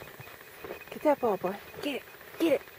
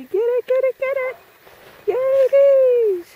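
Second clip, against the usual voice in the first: high excited cheering; yelling from the sidelines; 6 semitones higher, 4 dB louder, slower than usual. Real, not acted. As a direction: cheer the dog on loudly. Cheering dog to fetch.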